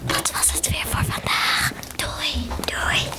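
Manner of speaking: breathy unvoiced ASMR whisper. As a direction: whisper close to the mic, ASMR style. ASMR segment with Lana and Gwen.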